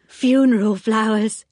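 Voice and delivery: eerie cheery announcement; odd Anthea. A narrator's reading. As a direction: announce the lilies a little too brightly. Anthea enters with lilies.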